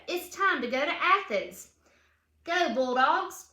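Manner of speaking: lively read-aloud storybook voice; reading to children. A narrator's reading. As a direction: read it like a picture book for kids. Reads picture book aloud.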